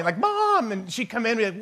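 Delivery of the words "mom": high, drawn-out call of mom from bed; retold yell for mom. Eight-year-old in bed calling out to his mom, high yell. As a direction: call out to mom like a restless kid. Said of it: "like, yelling".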